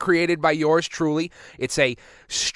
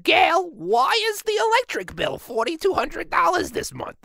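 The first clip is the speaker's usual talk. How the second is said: high-pitched nagging aunt; angry aunt; 11 semitones higher than usual. Acted. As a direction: demand answers from your husband in a much higher voice. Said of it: "unbeknownst to your aunt".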